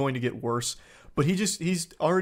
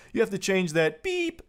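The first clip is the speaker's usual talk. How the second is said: high, held beep in place of the swear; imitated censor tone on BEEP; 3 semitones higher than usual. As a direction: read it out, bleeping the curse. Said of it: "He's literally beeping himself with a sensor".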